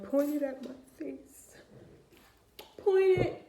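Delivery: loud, high-pitched melodramatic begging; over-the-top imploring man; mock desperate pleading. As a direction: beg at full pitch like a hammed-up martyr. Acting men begging to be hurt.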